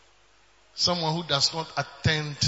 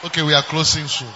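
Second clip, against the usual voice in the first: loud teasing; 3 semitones lower, 3 dB louder than usual. Real, not acted. Teasing congregation loudly.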